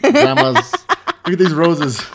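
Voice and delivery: giggling at the grandma-style phone case; laughing tease. Real, not acted. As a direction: laugh while mocking it. Teasing friend about phone case.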